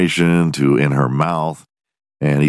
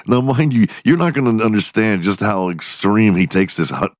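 ordinary delivery

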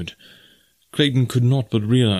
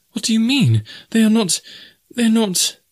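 high, alarmed protest; rising horror, pitched far up; horrified disbelief; 13 semitones higher than usual. A narrator's reading. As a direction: plead against the awful thought in a very high voice. Jane in horror at what is implied.

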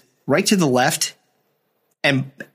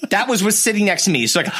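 ordinary delivery

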